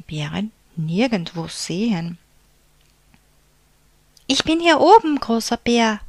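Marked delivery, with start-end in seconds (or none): 4.3-6.0 loud high call from above. A narrator's reading. Little bear calling down to big bear, loud call. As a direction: call down proudly from the rocks. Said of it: "rief der kleine Bär".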